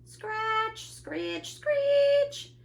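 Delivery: high squealed scratching sounds, last one loudest; piercing scrapes building to a shriek; screeching noise. A narrator's reading. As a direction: squeal each word like the noise. Imitating noise at the window.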